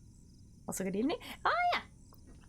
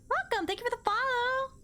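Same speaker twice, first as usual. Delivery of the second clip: bubbly, high-pitched thanks; high cheerful welcome; 11 semitones higher than usual. Real, not acted. Streamer thanks viewer for the follow, high cheerful welcome.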